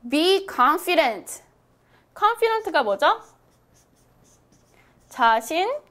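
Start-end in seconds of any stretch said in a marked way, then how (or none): 0.1-1.4 slow, clear model sentence for repeating; teacher reading aloud slowly for practice. Shown as a demonstration. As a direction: read it slowly so students can follow. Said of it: "소리 크게 내었어요".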